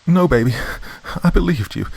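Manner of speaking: high, loud, frantic begging; begging for her life; desperate plea. Acted. Mother begs killer son.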